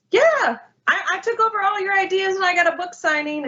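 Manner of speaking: high, fast, gloating; high smug boast from the sister. Retold or imitated. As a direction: taunt her with the stolen ideas. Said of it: "was like".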